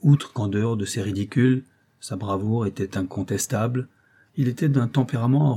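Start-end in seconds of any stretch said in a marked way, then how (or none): none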